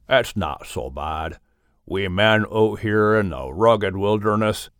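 a big friendly bear talking low and easy. Acted.

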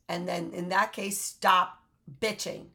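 ordinary talk

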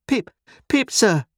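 put-on high, loud child's answer; frightened boy. A narrator's reading.

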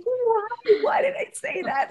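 high, squealing why did I say that; hammed-up dismay at her own words; a high, exasperated wail at herself; mock self-scolding. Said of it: "And then I was like".

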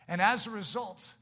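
usual speaking voice

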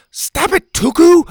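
loud, high yell. Acted. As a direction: yell at the thrower. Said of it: "Bo yelled".

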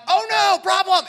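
high, loud mock panic; a hammed-up panic; panicked cry. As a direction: cry out in alarm at trouble. Someone panics facing a problem.

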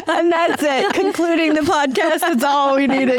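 high, laughing joke. Real, not acted. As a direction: say it still laughing. Joking through laughter.

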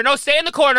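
loud command. Pretend. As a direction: order him back sharply. Bossing Alex into the corner.

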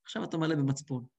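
ordinary delivery

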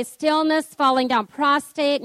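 ordinary delivery

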